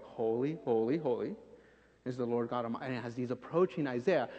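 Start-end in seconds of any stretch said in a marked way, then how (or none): 0.0-3.0 lower, softer holy holy; an angel singing quietly as he works; soft angel song. Retold or imitated. Angel murmuring praise while taking the coal, low and soft. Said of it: "he's like".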